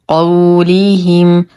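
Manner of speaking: slow chanted word, vowels held; Quran recitation. Shown as a demonstration. Teacher models the next word for learners, slow recitation. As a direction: recite it in measured tajweed.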